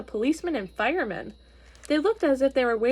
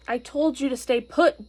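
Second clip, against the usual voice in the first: ordinary delivery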